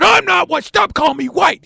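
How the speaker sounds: high-pitched exasperated shout; acted angry denial. Preacher fed up with being mislabeled, angry denial.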